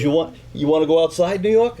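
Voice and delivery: high, loud taunt to step outside; rising loud dare; tough-guy challenge. Retold or imitated. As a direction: call him out, spoiling for a fight. Big guy challenges him outside.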